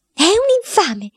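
aunt Bettina spits out her verdict; aunt's shrill accusation. Acted. Aunt Bettina denounces the boy to his father, shrill. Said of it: "ha aggiunto la zia Bettina".

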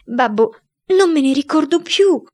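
lamento acuto e forte; piagnucolando. A narrator's reading. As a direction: supplica il babbo frignando. (il figlio supplica il babbo, piagnucolando)

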